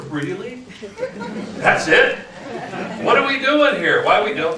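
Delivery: as if peeking over the fence and finding so little; incredulous. Retold or imitated. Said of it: "peeking over the fence going".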